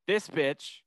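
lightly voiced pop-star impression; a hint of a celebrity singer's voice. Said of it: "in my most, uh, [name] voice".

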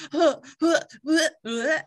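high, loud mock-grossed-out huhs; disgusted laugh. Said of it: "I was like".

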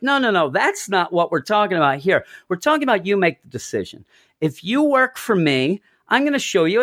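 high, insistent quote; lightly hammed-up pushy Mephisto; mock Mephisto. Mephisto pressing Deadpool to decide, mock insistence.

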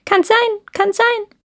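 nod it out like a thoughtful crow; raspy cawing crow. Acted. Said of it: "Die Krähe nickte bedächtig und sagte".

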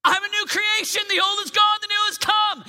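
loud, high proclaiming; bold declaration. Acted. Teen proclaiming scripture at the devil, loud and high. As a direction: shout it like a battle cry. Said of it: "Take this verse all week long".

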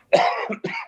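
rough dry cough; hungover cough. Real, not acted. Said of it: "Excuse me, I'm hungover".

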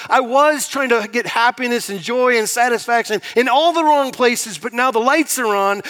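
high and loud, full-voiced pulpit delivery; preaching at full force. Pastor preaching to the congregation about renewal, loud and high. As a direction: drive it home like a revival preacher.

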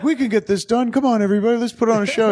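high-pitched Little Rascals show-organizer; rally the gang in a high, eager voice; can-do cheerleader. Retold or imitated. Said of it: "There's such a can-do about".

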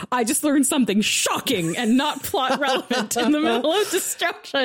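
high laughing quote of her message; giggling read-out; laughing while quoting. Retold or imitated. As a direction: read your own text through giggles. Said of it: "It was".

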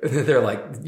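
usual speaking voice

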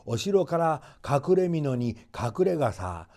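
usual speaking voice

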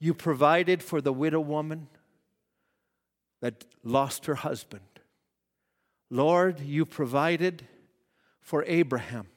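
quiet faith-filled prayer; prayerful trust. Retold or imitated.

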